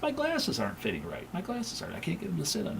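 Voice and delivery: lightly mock her fussing about the glasses; imitating the wife. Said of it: "she noticed".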